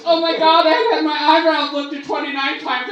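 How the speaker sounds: high, loud excited squeal; gushing woman. Retold or imitated. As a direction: gush over the coincidence.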